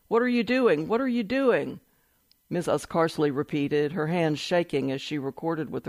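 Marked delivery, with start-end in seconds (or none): 0.1-1.8 higher-pitched, urgent questioning without shouting; mildly alarmed pleading. A narrator's reading. Wife challenges agents handcuffing her husband, mildly alarmed pleading. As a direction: narrator lightly voicing a distressed repeated demand.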